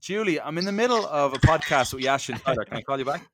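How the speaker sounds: high, hurried brush-off to sister; quick polite brush-off on the phone; dodging a caller. Real, not acted. Sister FaceTiming mid-podcast.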